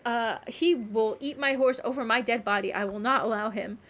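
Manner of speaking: normal talking voice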